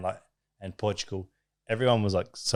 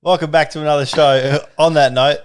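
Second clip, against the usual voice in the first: loud, higher-pitched mock presenter intro; big hammed-up host welcome; mock show host; 4 semitones higher, 6 dB louder than usual.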